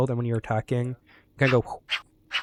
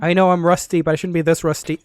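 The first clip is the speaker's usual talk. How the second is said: exasperated; 3 semitones higher, 7 dB louder than usual. Real, not acted. Self-scolding after falling.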